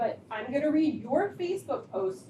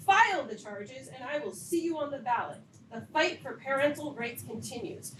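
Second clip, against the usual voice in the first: usual speaking voice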